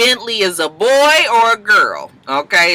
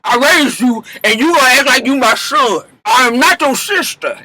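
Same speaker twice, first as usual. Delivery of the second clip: higher mock-indignant mother's voice; scolding Mama Dap; 6 semitones higher, 3 dB louder than usual. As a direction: put the son in his place, high and huffy. Mama Dap scolds her son.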